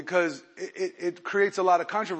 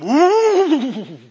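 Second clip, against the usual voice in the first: laugh; 6 semitones higher, 9 dB louder than usual. Real, not acted. Laughs at Jerusalem.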